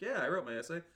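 lightly voiced workman boasting; half-laughing mock boast; hinted worker voice. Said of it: "with an accent I'm not gonna do".

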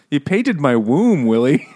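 slow mock Boston, "room" said as "womb"; Boston accent. Mistress complaining to Willie, hammed-up Boston accent. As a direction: exaggerate the Boston R, rounding it into a W. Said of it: "can't even say the first R in a word. She's so Boston".

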